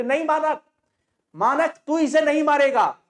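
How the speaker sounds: mother blocking the rifle butt, pleading; pleading cry. Acted. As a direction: beg him to stop. Bisni begs Manak to stop.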